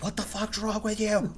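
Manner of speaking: irritated mom. Retold or imitated. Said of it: "She's like".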